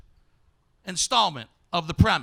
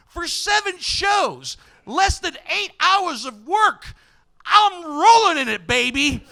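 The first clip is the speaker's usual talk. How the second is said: higher and louder, laughing gloat; mock excited bragging; 9 semitones higher, 6 dB louder than usual. Comedian gloating to the crowd about his paycheck. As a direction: show off the windfall with glee.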